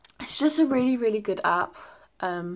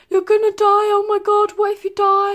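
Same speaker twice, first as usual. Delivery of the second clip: high, loud wailing sob, much higher and louder; crying hysterically; 12 semitones higher, 11 dB louder than usual. Retold or imitated. Phoning her dad at one in the morning, hysterical crying wail. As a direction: replay the hysterical phone call in a wail. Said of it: "crying hysterically down the phone".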